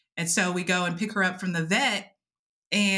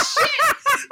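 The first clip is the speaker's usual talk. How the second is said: laughing shock; 6 dB louder than usual. Retold or imitated. Sudden realization, laughing.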